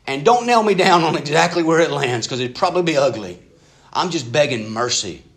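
a hammed-up anxious father blurting out his doubts; desperate father. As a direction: confess half faith, half doubt, begging. Desperate father's honest prayer.

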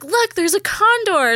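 high, slow retold exclamation; feigned excitement. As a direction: imitate him calling out a sighting. Retold pointing out a condor.

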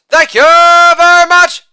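high, loud, sustained catchphrase; showman's ringing thank-you; showy sign-off. Acted. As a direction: belt it out on one held high note.

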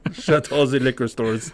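put-on jokey German accent, th turned to z; mock German accent. Comic retelling of Hitler's rise, mock German accent.

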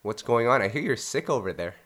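ordinary delivery

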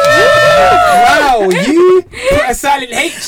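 high, loud mock outrage; astonished teasing. Real, not acted. Co-host teasing the botched name, astonished. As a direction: shriek it in amused disbelief.

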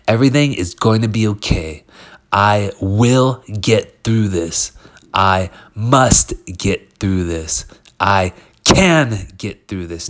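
slow, forceful emphasis on each key word; powerful affirmation. Shown as a demonstration. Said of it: "you hear that, that, that power in the words".

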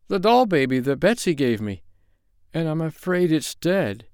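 a touch of sobbing in a thin voice. Acted. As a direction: sadly choke out the bad news. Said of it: "Poor Jimmy Scarecrow sobbed".

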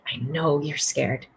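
soft, slow, reassuring; calm him down gently; quiet soothing reassurance; gentle reassurance. Retold or imitated. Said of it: "She said".

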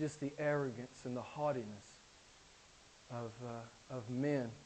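low, soft, halting sermon voice; subdued preaching. Real, not acted. Preacher lamenting the world's mockery of Jesus, subdued preaching. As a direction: drop the volume and confide it.

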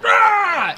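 high mock roar at a virus; mock scream. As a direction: let out a silly yelp. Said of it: "he just like".

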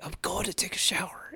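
sneaky late-night whisper. Retold or imitated. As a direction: whisper the plan like a secret.

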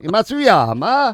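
drawn-out Italian-style "Matsuyama"; Italian accent. Pretend. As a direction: say it like an Italian, slow and sung. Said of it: "pronounced in an Italian accent".

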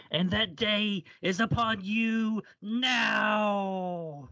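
slow, high, wailing movie-villain cry; dramatic movie quote. Acted. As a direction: draw out the big dramatic line.

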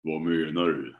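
low, dragged-out imitation of a cashier; deepened, slowed-down question; dark distorted voice. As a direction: say it like a slowed tape. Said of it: "som att jag är sinnessjuk och, och säger så här".

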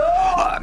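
kurzer, hoher, lauter Schrei; plötzlich aufschreien; erschrockener Aufschrei. Acted. Ausbruch im Verhör. (schreit beim Tumult erschrocken auf)

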